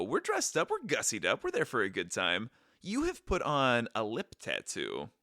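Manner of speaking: ordinary talk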